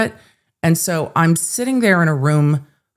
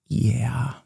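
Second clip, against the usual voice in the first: much softer, lower "yeah"; a hint of an uneasy presenter muttering; mock awkward man; 6 semitones lower, 8 dB quieter than usual. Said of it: "And he was like".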